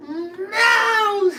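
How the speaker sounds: long, high-pitched crying "no"; hammed-up heartbroken wail; mock wail. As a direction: wail it out high, like a comic sob. Acting out his heartbreak at her leaving.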